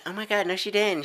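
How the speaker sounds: high, gushing mock girl voice; lightly voiced gossip girl.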